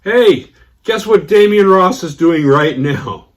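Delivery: high, loud, slow holler; hollering to wife. Retold or imitated. Friend hollering to his wife in the next room. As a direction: call out across the house. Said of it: "I hear him holler to his wife".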